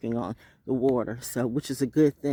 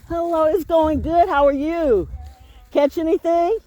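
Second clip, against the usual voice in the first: chirpy greeting; 10 dB louder, slower than usual. Real, not acted. Chatting with passing fisherman.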